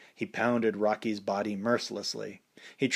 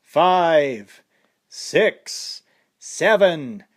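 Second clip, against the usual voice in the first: loud, higher count over the fallen boxer; referee counting; 6 semitones higher, 8 dB louder, much slower than usual. A narrator's reading. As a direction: count him out firmly. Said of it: "the referee counted".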